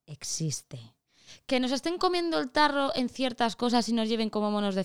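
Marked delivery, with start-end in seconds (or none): 0.1-0.8 whisper. Real, not acted.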